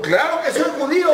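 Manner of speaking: very high indignant exclamation. Retold or imitated. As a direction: exclaim it as if affronted by the doubt. Driver indignant at being doubted.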